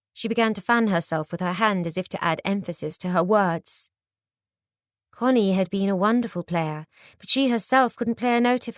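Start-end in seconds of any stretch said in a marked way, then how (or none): none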